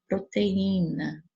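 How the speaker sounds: "Proteína" drawn out, leaning on í; slow pro-te-Í-na with a long í; stress on the Í. Shown as a demonstration. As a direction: stretch the strong I in "Proteína". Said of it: "o I é mais forte".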